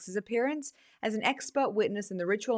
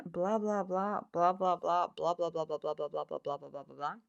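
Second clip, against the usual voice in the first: dismissive blah blah; 3 semitones lower, 5 dB quieter than usual. Acted.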